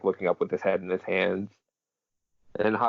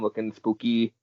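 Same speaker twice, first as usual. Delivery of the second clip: normal talking voice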